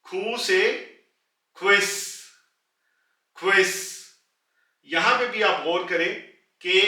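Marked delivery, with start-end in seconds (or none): 1.6-1.9 क्विस with a sharp एस at the end, said loudly; German pronunciation. Shown as a demonstration. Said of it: "वो एस की निकलेगी". 3.4-3.7 क्विस with a sharp एस at the end, said loudly; German pronunciation. Shown as a demonstration. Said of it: "वो एस की निकलेगी".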